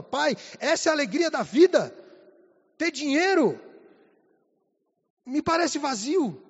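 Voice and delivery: retold teenage questioning. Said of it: "Eu falava".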